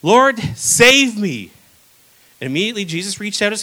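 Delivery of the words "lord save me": slow, loud cry for help; panicked cry. Retold or imitated. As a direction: cry out as you sink. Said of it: "he cried out".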